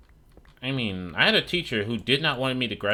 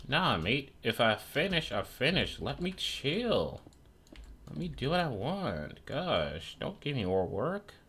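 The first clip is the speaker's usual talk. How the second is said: higher, softer, whining at the teacher; a put-upon student, higher and softer, sulking; mock whiny student; 6 semitones higher, 4 dB quieter, slower than usual. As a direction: pout through a lazy student's complaint. Student mocking teacher's extra work.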